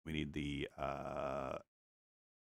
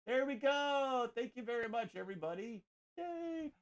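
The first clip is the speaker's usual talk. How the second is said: high cheery thanks; 13 semitones higher, 4 dB quieter than usual. Real, not acted. Finishing the painting.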